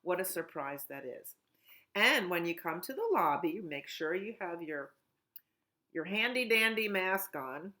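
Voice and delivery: ordinary talk, nothing marked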